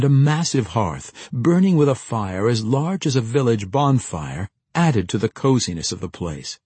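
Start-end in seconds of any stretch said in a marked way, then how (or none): none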